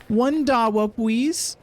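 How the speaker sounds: high, slow "one dowwa pwease"; paper boy. Acted. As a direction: lisp "please" as "pwees" like a little boy. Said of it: "Talk to the paper boy".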